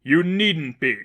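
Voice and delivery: forceful holy figure; stern seraphim. A narrator's reading. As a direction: lay down the law. Seraphim commands Joshua.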